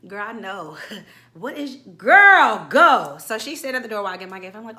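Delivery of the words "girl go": loud, fed-up yell; exasperated shout. Real, not acted. Fed up with the clingy friend, loud exasperated shout. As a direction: blurt it out loud in disbelief.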